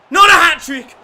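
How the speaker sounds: high, loud cry of protest; outraged shout. Real, not acted. Gamer yelling in protest at the goalkeeper, high and loud. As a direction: yell in disbelief at the screen. Protesting the opponent's hat-trick.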